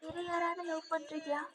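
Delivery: barely audible, high and small; timid tiny voice. Acted. Alia asking V about another love, tiny high voice. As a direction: ask it as softly as possible. Crying girl asks timidly.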